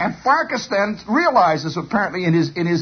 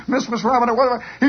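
plain reading voice

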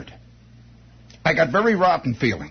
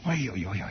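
inner moaning; 13 semitones lower, 11 dB quieter than usual. Retold or imitated. Inner sound ringing in skull.

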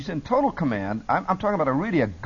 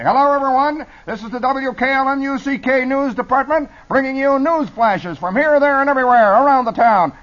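high, loud newsflash proclamation; full-blast old radio news voice; urgent newsroom announcer; 12 semitones higher, 8 dB louder than usual. Acted. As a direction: shout the headlines over the ticker. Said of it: "Completely different voice".

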